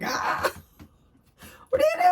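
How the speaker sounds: sudden high, loud yelp; jolted awake by the sting; startled outcry. Retold or imitated. Retold reaction to smelling salts.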